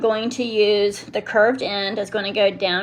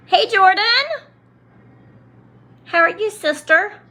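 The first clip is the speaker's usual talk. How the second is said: excited greeting; 10 semitones higher, 4 dB louder than usual. Real, not acted. Greeting a viewer in chat.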